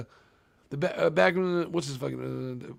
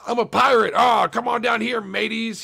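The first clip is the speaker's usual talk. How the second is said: hammed-up pirate, higher and loud; cheesy pirate; 6 semitones higher, 6 dB louder than usual. Furniture store manager in a cheap commercial, hammy pirate shout. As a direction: bellow like a cartoon pirate in an ad. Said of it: "stupid ass".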